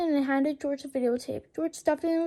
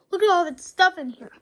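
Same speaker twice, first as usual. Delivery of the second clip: excited; 4 semitones higher, 8 dB louder than usual. A narrator's reading. Excited discovery.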